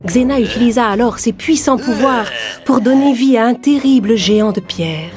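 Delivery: ordinary delivery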